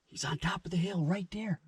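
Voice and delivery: hushed whisper. Real, not acted. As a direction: keep it to a whisper. Deer approaching the hunters.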